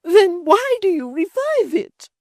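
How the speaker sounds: put-on high, slow whimpering old mother. A narrator's reading. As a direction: whimper it tearfully. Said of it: "whimpered her mother".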